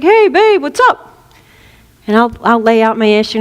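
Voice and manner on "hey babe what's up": Uncle Tony. Retold or imitated. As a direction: greet the caller easily like a relaxed uncle. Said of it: "he's like".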